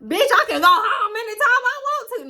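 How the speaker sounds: high, laughing fake bravado; bluffing bravado through laughter; playing it off. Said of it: "I had to play it off".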